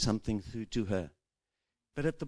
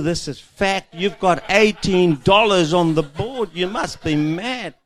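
blunt husband; 9 semitones higher, 12 dB louder, slower than usual. Retold or imitated. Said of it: "like we men do".

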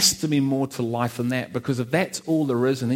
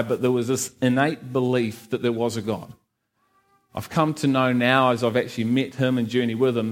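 usual speaking voice

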